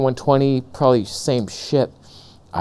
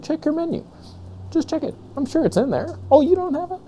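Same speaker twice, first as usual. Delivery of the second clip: much higher, softer, teasing singsong; mock taunting; 4 dB quieter, faster than usual. Smug camera owner mocking full-frame users, teasing high singsong. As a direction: needle them like a smug rival.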